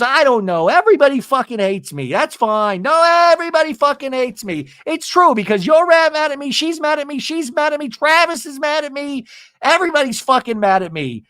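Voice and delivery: high-pitched mock tantrum; hammed-up everyone-hates-me rant; retold self-pitying meltdown. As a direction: wail the grievances in a rising list.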